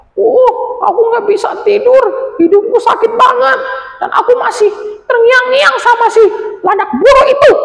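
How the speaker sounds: very high, aching moan; sleepless complaint. Acted. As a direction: moan about your sore nose. Anjani sleepless at night.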